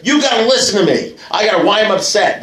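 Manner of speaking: high-pitched, worked-up acted plea; insistent demand. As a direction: demand a hearing, pushing the pitch up. Acts out someone demanding a hearing.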